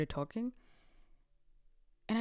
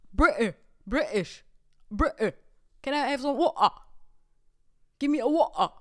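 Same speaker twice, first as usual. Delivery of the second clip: put-on British accent; 3 semitones higher, 9 dB louder than usual. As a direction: mimic a Londoner ordering water, overdone. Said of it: "I thought it's water".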